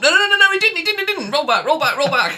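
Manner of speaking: shrill high frantic protest, laughing at the end; panicked cheating. Pretend. Reader beaten by the dwarf, panicking to undo it. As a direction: frantically take it back.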